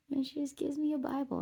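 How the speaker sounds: normal talking voice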